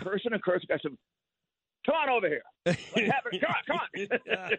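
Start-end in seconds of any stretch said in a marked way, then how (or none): 1.9-4.6 egging the yelling guy on, amused; mock challenge. [name] retelling answering a yelling guy outside a party, laughing taunt. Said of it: "this guy starts yelling at me".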